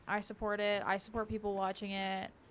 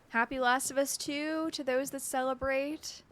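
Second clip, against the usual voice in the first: high, slow, mock-festive; sing-song wish; 4 semitones higher, 5 dB louder, slower than usual. Real, not acted. Streamer wishing chat a mock holiday, sing-song. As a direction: announce it like a holiday greeting.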